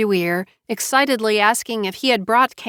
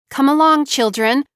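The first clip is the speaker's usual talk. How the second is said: higher, louder motherly call; calling children; 6 semitones higher, 5 dB louder than usual. A narrator's reading. Mother herding her girls into the other room, calling out. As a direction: call out to round up the little ones.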